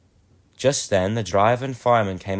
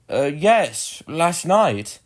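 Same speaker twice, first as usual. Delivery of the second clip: higher, hesitant daft answer; dim porter; 7 semitones higher, 4 dB louder, much slower than usual. Acted. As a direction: answer cluelessly, drawn out. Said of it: "said the porter".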